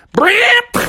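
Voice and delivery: very high buzzing burst; engine roar noise. Retold or imitated. Storyteller showing Bubby's bike shooting away, imitated rev. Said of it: "He went".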